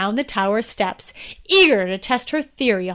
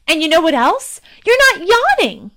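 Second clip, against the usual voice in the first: triumphant princess; 6 semitones higher, 5 dB louder than usual. Acted.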